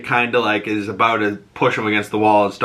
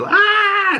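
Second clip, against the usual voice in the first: short, very high mock shriek; high-pitched yelp imitating a scream; imitated scream; 3 dB louder than usual. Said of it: "flashes back to her screaming".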